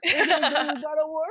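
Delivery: high, fast thrilled announcement; giddy excitement. Retold or imitated. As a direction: blurt it out in glee. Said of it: "And I'm like".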